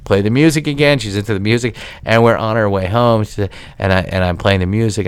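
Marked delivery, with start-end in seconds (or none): none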